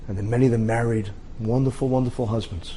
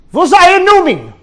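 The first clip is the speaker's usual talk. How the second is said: high, loud shout in German; German soldier calling back to ask his rescuer's name; 16 dB louder than usual. Acted. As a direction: call out desperately in German.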